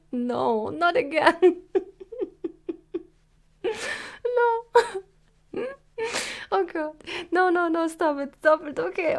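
high laughing protests; being tickled. Acted. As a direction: giggle and beg them to stop. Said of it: "I'm ticklish".

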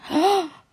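loud sharp cry of pain. Acted. Sudden pain.

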